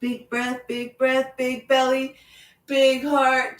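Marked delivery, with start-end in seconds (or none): none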